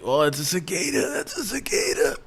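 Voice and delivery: high-pitched, gleeful imitation of a friend; friend's excited cry. Homie hearing the zapper at a pool party, imitated excitement. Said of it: "my friend would be having the best time".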